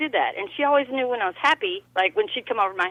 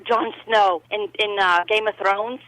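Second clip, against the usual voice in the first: ordinary talk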